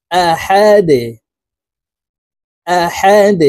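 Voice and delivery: আহাদ ending with a bounced, echoing d; qalqala on dal. Shown as a demonstration. Teacher to viewers demonstrating the qalqala on আহাদ. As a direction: push the final d of আহাদ down with a jolt. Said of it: "ধাক্কা দিয়ে উচ্চারণ করব".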